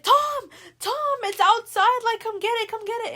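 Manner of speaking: high, loud urgent yell to someone in the shower; calling out. Retold or imitated. Lily yelling to Tom in the shower, loud urgent call. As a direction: shout him out of the shower like it's an emergency.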